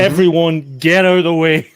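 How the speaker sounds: shouted warning. Acted. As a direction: shout to clear everyone from the line of fire. Player calls warning before shotgun fires.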